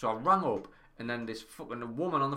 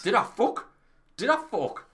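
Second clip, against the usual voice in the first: indignant outburst; 11 semitones higher, 7 dB louder than usual. Retold or imitated.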